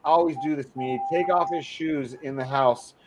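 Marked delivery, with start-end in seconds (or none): none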